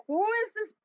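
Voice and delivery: mock bewilderment. Voicing viewers confused by Boris's many actors, mock bewildered. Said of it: "everyone's just like".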